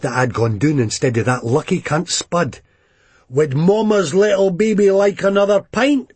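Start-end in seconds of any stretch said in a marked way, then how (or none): 3.3-6.1 mock baby-talk taunt, higher, rising; needling a mate in the pub; a hint of a sneering hard man; Begbie mocking. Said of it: "Begbie asked".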